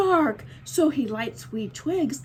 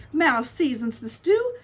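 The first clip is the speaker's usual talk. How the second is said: plain reading voice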